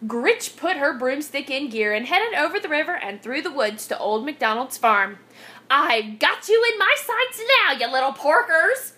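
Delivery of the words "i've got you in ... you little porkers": gloating witch, pitched up at first; mild witchy cackle in the voice; cackling witch. A narrator's reading. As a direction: crow it at your prey. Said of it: "she cackled".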